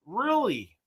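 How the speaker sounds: high, rising disbelief on Really?; a quick startled Really? going up high; surprised really. Real, not acted. Viewer says keep ranting, surprised high Really?.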